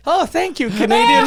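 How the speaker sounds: high, loud mock thanks; mock grateful. Thanking the waitress for the Canadian's drink, high and loud. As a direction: thank her in a hammed-up high voice.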